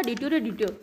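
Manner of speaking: "डिट्यो रे डिट्यो" softly, with lisped hard ट sounds; understated lisp on "डिट्यो रे डिट्यो", falling at the end; lisping speech. A narrator's reading. Son repeats his cry to his mother, lisping. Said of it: "वो थोड़ा तुतला कर बोलता था".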